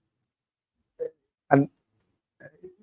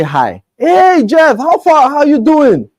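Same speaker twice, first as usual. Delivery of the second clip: bright, much higher, louder hello to a friend; cheery greeting; 13 semitones higher, 5 dB louder, slower than usual. Retold or imitated. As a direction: greet him like an old buddy. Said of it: "if I say".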